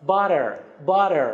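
"butter" with the double T softened to an R-like tap; "butter" sounding like "burrer"; American tapped T. Shown as a demonstration. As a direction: say "butter" the American way, tt as a quick flap. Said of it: "در تلفظ امریکایی".

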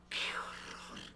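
whispered gasp of horror; hushed horrified whisper; shocked whisper. Acted. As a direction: breathe it out in shock, no voice. Mother hears bad news on phone.

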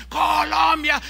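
high, loud fan chant; crowd shouting. Retold or imitated. Football fans cheering their national team, shouted chant. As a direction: yell the country like a stadium chant. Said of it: "empezaron a gritar".

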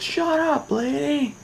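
high mock yell; retold yell. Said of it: "I actually just yelled".

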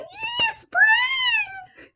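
very high, thin squeal of joy; hammed-up high-pitched little cheer; excited cheer. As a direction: squeal it in a tiny celebrating voice. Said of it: "I'm just like".

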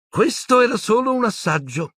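high, gloating threat; cruelly pleased woman; Bellatrix. Acted. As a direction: taunt in a high wicked voice. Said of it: "disse Bellatrix".

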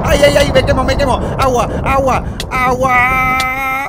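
loud high screams of panic; panicked screaming. Acted. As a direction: scream like you're on fire. Se quema con TNT. (gritos de pánico, me quemo)

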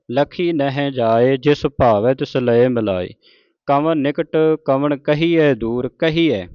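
slow, measured verse reading; scripture recitation. Shown as a demonstration. Teacher reciting Gurbani to the congregation, slow and measured. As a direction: draw out each sacred word.

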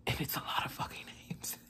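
hushed, choked-up whisper; tearful whisper. Real, not acted. Vlogger tearing up over the covid names, whispering. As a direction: whisper it, overcome by the news. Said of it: "I'm an emotional wreck".

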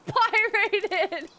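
loud, high-pitched, cracking up; laughing disbelief. Real, not acted. Streamer mocks how pirated was pronounced, laughing disbelief. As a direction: shriek it through laughter.

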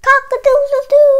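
very high, loud crowing; rooster crow. Acted. Voicing the rooster in a picture book, loud high crow. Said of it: "crowed the rooster".

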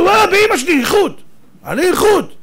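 loud, high-pitched swearing to it; insistent protest. Acted. Shirt buyer swearing to the rabbi it's quality, loudly insistent. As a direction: protest at the top of the voice.

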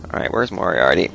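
normal talking voice

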